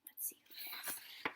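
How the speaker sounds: quick hushed whisper; suspense whisper. Shown as a demonstration. Reader whispering to children before the reveal. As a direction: whisper it like a secret. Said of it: "aside to listeners, building suspense".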